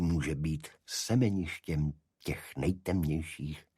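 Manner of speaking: ordinary talk, nothing marked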